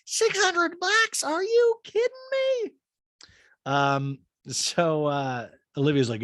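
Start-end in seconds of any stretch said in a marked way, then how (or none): 0.0-2.7 shrill, much higher, slow disbelief; Scott Disick. Retold or imitated. Podcaster doing Scott Disick, squeaky high disbelief. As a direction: squeak out the outrage, stretched. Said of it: "I'm Scott Disick".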